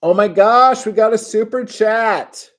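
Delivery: very high, loud "oh my gosh" squeal; excited squeal. Real, not acted. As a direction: shriek with delight at the super chat. Surprise super chat.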